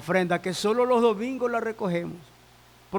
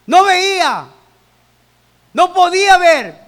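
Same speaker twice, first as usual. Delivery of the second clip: loud, emphatic Bible storytelling; forceful preaching; 6 semitones higher, 10 dB louder than usual. Acted. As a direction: tell the story at full pulpit force.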